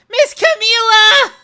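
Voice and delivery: high, loud, drawn-out cry of her name; a little dog yelping for Camila; excited call. Acted.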